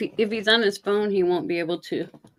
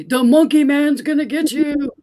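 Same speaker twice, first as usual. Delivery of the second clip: spooky dad voice; 7 semitones higher, 7 dB louder than usual. Retold or imitated. Dad scaring kid in retold story.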